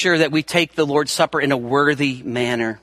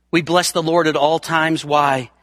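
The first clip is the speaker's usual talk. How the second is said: ordinary talk, nothing marked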